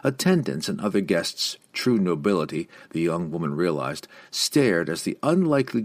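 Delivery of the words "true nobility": ordinary delivery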